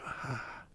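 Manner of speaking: very quiet, voiceless exhaled "ah"; soft contented sigh. Shown as a demonstration. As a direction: breathe out softly, letting it go. Demonstrates just being aware, accepting.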